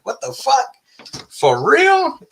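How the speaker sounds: very high-pitched disbelief; retold amazement. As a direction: re-enact being floored, voice leaping up.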